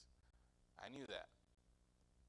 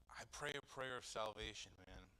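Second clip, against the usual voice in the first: usual speaking voice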